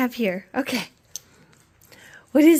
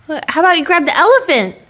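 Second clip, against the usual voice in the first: ordinary talk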